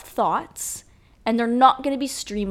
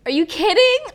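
higher, louder incredulous cry; outraged; 9 semitones higher, 6 dB louder than usual. Real, not acted. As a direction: cry out in disbelief. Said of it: "outraged rhetorical question".

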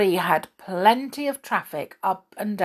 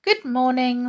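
polite; 6 semitones higher than usual. A narrator's reading. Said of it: "said the squirrel politely".

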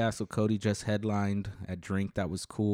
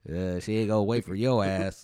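lightly twang it, country style; hillbilly voice; 6 dB louder than usual. Acted. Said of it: "like a hillbilly".

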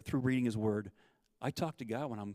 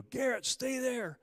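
high, slow yell across the water; stretched-out mock scream; retold scream; 13 semitones higher, 3 dB louder, slower than usual. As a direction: call out to the boy over the rushing river. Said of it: "and I'm screaming".

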